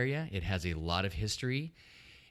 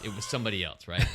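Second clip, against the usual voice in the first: normal talking voice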